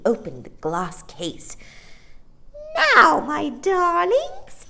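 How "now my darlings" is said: high, slow, louder coaxing; sweet different voice. A narrator's reading. Old shopkeeper turning sweet to the children. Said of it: "in quite a different voice".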